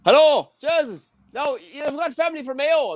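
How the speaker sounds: high, hammed-up thick Irish brogue; a mock rural Irish presenter; Irish host impression. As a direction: play the most Irish man alive. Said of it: "the most Irish man in the world".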